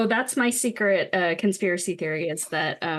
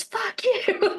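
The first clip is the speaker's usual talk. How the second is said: pitched-up, held-back mock shout; mock yell; 12 semitones higher than usual. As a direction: imitate a yell without the volume. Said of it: "when Bender yells".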